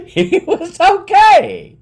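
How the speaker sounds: loud, high, slow laughing words; amused high-pitched outburst; laughing while talking. Real, not acted. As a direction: exclaim it through laughter. Laughing as he gives the moral.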